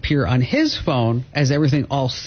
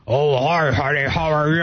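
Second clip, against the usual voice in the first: imitating prankster son. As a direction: mimic the son posing as her husband. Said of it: "That's a dead-on impression".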